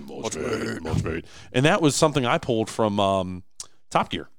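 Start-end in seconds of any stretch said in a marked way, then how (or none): 0.0-0.8 low drawn-out sign-off with a rising end; lightly voiced catchphrase, lower and softer; video sign-off call. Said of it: "I'm always going". 0.8-1.2 lower, softer second call of the name; low soft outro echo; sign-off echo. Retold or imitated. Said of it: "I'm always going".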